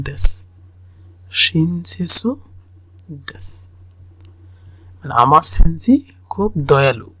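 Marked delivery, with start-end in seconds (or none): none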